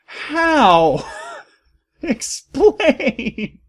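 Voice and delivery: baffled, demanding an explanation; incredulous. Real, not acted. Reader baffled by the absurd plot, incredulous. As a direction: pitch shooting up in disbelief.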